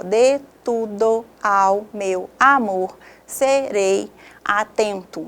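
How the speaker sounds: very slow, every syllable separated by a pause; syllable-by-syllable division. Shown as a demonstration. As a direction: break "de tudo ao meu amor serei atento" into separate grammatical syllables. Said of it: "a divisão por sílabas gramaticais".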